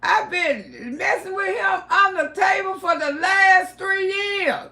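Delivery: high, loud, laugh-cry shout. Retold or imitated. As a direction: shout the confession through laughter. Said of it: "I said".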